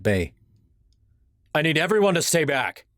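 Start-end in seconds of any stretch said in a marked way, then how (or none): 1.5-2.9 yelling. A narrator's reading. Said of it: "he yelled".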